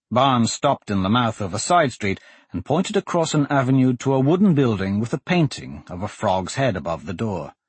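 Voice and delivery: usual speaking voice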